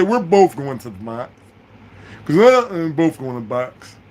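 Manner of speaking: mock a tearful, stretched-out complaint; friend's wailing plea. Friend wailing about the box.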